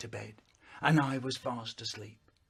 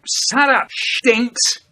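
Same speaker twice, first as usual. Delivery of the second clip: higher, much louder shouted retort; mock angry retort; 7 semitones higher, 12 dB louder, slower than usual. Shouting back at a brother, higher and loud. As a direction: yell it back at your brother. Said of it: "I say".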